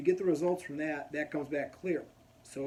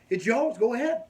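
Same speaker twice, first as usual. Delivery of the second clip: higher, louder brash nurse voice; 9 semitones higher, 9 dB louder than usual. Retold or imitated. Nurse waving the patient on, brash and loud. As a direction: imitate an abrasive, no-nonsense nurse. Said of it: "brash, more aggressive, more abrasive".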